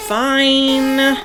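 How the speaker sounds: reluctant surrender; grudging fine. Acted. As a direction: concede through clenched teeth. Forced to give in.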